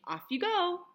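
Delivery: high, sing-out send-off; cheery exclamation. A narrator's reading. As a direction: send her off with a lifted voice. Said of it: "the fairy godmother exclaimed".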